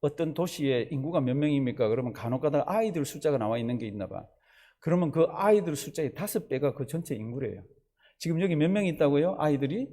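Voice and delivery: ordinary talk, nothing marked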